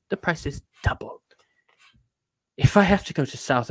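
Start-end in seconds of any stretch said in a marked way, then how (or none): 0.1-1.2 softly whispered, confiding; whispered aside. Real, not acted. Explaining his contract pricing to the audience, whispered. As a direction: drop to a whisper like a secret.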